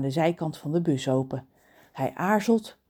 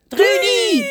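very high, loud surprised cry; joyful recognition; surprised shout; 11 dB louder than usual. Acted. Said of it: "roepen Reus, John en Kraai". (verrast roepen)

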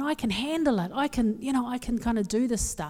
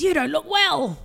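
lightly imitated blunt British mate, pitched up; cheeky British friend; 6 semitones higher than usual. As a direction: tell it straight as the cheeky British pal. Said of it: "He's British, very cheeky".

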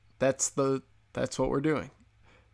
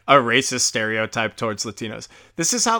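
ordinary talk

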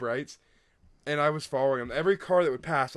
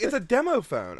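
higher-pitched, mildly mad protest; acted-out frustrated objection; retold exasperation; 8 semitones higher, 3 dB louder than usual. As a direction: imitate yourself losing patience, pitched up. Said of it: "I was getting so mad".

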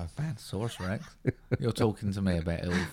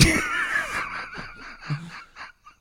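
wild yell. Real, not acted. Wild yell after the impression.

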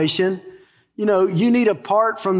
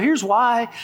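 ordinary talk

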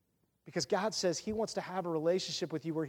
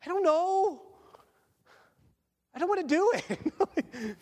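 reluctant whine; 10 semitones higher, slower than usual. Retold or imitated. Arguing back at God.

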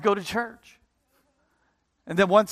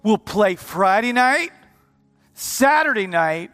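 usual speaking voice

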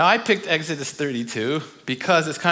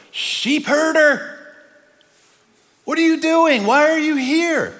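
higher, louder, slow incredulous challenge; God confronting; 13 semitones higher, 7 dB louder, slower than usual. Acted. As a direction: demand it in disbelief. God confronts Moses in exile.

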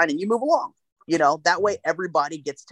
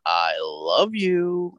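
very slow, deadpan "I love you"; Goldblum "I love you"; much slower than usual. Retold or imitated. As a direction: stretch each word, looking him dead in the eyes. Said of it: "he goes".

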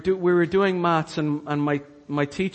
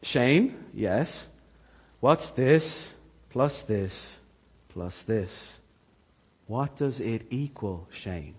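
teacher coaxing; 5 semitones lower, 4 dB quieter, much slower than usual. Retold or imitated. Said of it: "and she's going".

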